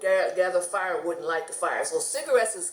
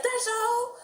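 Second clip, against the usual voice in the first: pleased surprise. Retold or imitated. Car price surprisingly cheap.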